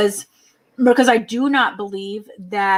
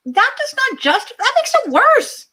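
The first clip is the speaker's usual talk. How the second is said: much higher, slow disbelief; exasperated disbelief; slower than usual. Retold or imitated. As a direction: squeak it out in disbelief. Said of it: "I'm like".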